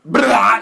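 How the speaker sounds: loud mock vomiting sound; retching blah. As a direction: gag it out loudly. Zeus throwing up.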